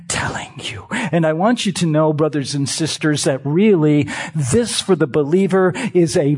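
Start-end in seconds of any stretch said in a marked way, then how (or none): 0.1-0.9 preacher whispering the key line. Real, not acted. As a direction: drop to a whisper for weight.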